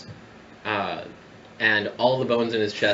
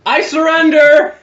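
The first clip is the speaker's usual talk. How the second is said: panicked shouting necromancer; 13 dB louder, slower than usual. Acted. Necromancer begs, GM voices.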